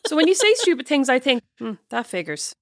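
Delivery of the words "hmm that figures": low, soft mock musing; dry aside. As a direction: think it quietly to yourself, unimpressed. Sister voices her dry private reaction.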